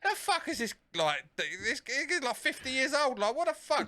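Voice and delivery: high, mocking voice of stunned thugs; incredulous gangsters. Retelling the gangsters' reaction to the old man, high disbelieving voice. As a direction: squeak out their disbelief like baffled hoodlums. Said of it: "and they're like".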